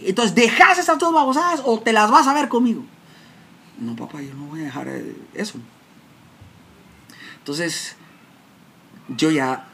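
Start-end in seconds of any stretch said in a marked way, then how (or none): 0.1-2.8 much higher, louder threatening yell; father's threat. Retold or imitated. Father threatening his son to quit. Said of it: "Y insultándome". 3.8-5.6 son's quiet refusal. Retold or imitated. Son answering father quietly.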